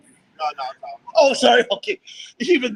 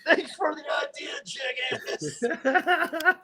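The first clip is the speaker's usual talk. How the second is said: higher, half-crying mock yell; shouted thanks; 5 semitones higher, 5 dB quieter, much slower than usual. Real, not acted. Winded prankster yelling thanks at Chili, half laughing.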